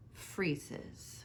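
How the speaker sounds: hushed whisper; suspenseful whisper. A narrator's reading. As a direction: breathe the word out in suspense. Crowd freezes in suspense.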